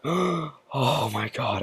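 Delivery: gasp, then a high, soft, drawn-out wail; breathless mock horror, stretched out slowly; acted shock. Rider facing everybody in line, acted gasping shock.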